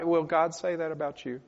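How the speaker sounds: normal talking voice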